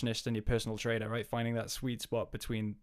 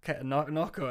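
usual speaking voice

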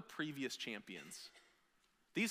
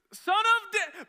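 much higher and louder, slow, far-carrying cheer; children's cheer; 13 dB louder, slower than usual. Retold or imitated. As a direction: shout it like a crowd of kids. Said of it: "They're like".

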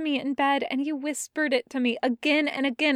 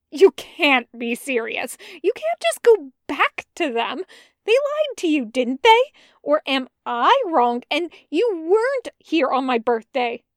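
louder, higher, accusing; angry confrontation; 4 semitones higher, 6 dB louder than usual. Acted. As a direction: throw the betrayal at him. Jester angrily confronting Bren.

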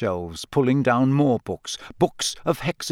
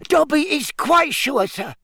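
high squeaky sincere elf; earnest squeaky elf; 13 semitones higher than usual. A narrator's reading. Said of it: "said the elf earnestly".

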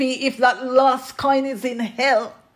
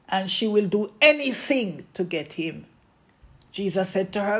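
ordinary talk

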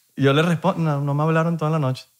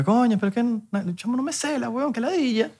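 put-on high, soft whiny girl's voice; hammed-up girly whine; imitating a girl complaining; 9 semitones higher, 4 dB quieter than usual. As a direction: mimic her fed-up complaint in a higher, softer voice. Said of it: "hablando con una chama".

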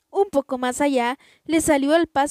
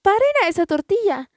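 shouting; 7 semitones higher than usual. A narrator's reading. Family yells to passers-by to stop the tortilla, shouting. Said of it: "gritándole a la gente".

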